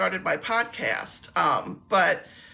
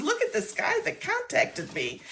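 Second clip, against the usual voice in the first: much higher, a little softer, as if showing an odd photo; a pitched-up telling of her own amazed words; amazed retelling; 11 semitones higher, 4 dB quieter than usual. Retold or imitated. Showing coworker a scammer's photo.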